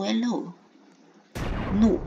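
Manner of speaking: usual speaking voice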